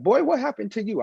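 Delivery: high, startled father; dad sees his soaking wet boy, high surprise; surprised dad. Retold or imitated. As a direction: pitch it up like a dad shocked by his drenched son. Dad sees soaked son.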